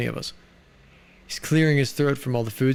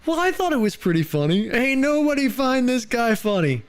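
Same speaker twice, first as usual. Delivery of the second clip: mock viewer voice; 9 semitones higher than usual. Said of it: "Killer Hawk goes".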